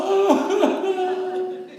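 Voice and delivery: high mock wail; hammed-up bawling; imitated crying. Brother tied up in a candlelit bathroom, hammed-up crying. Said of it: "I started crying".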